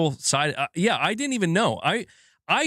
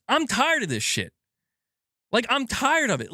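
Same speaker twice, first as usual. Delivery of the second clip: higher-pitched exasperation at usual volume; fed-up venting; 8 semitones higher than usual. Retold or imitated. Streamer venting at publishers, exasperated. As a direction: lean on TIRED, fed up.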